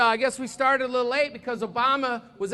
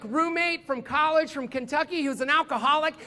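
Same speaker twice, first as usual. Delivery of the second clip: ordinary delivery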